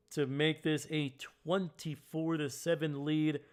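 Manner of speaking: ordinary talk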